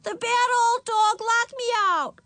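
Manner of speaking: put-on loud, very high child's wail; crying out. A narrator's reading. Ramona blaming the dog while rattling door, loud wail. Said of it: "cried Ramona".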